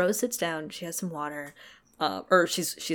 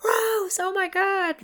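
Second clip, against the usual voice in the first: high-pitched imitation of a cheering crowd; high, drawn-out fan cheer; mock crowd cheering; 14 semitones higher, slower than usual. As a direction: cheer like a crowd going bananas, much higher. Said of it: "cheering and going".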